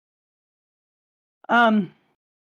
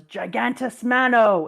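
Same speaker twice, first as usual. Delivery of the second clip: attack call; 3 semitones higher, 6 dB louder, slower than usual. Retold or imitated. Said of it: "she stands there and goes".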